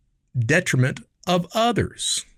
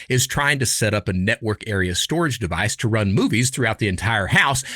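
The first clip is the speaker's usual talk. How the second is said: ordinary talk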